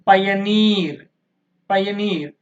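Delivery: pioneer with a drawn-out, stressed neer; last-syllable stress. Shown as a demonstration. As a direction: lean hard on the neer of pioneer. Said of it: "last syllable". (pioneer లో చివరి neer పై ఒత్తిడి)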